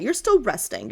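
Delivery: usual speaking voice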